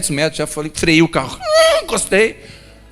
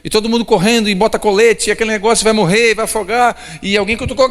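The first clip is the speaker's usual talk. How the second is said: raised, loud sermon build to the storm; fiery storm sermon; preaching at full force; 9 semitones higher, 3 dB louder than usual. Acted. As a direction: preach it high and urgent as the boat sinks.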